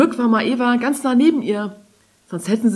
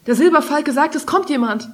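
higher-pitched, urgent relay of news; startled warning; 8 semitones higher, 4 dB louder than usual. A narrator's reading. Maeva relays the falcon's warning to Kari, startled. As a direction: pass the warning on, jolted alert. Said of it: "fuhr hoch und sah sich um".

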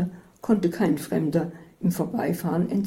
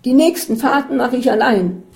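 loud, higher fed-up bellow; roaring; 7 semitones higher, 9 dB louder than usual. A narrator's reading. Katharina bellowing at Isabel after the drive, loud and fed up. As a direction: roar the decision at her. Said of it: "brüllte Katharina".

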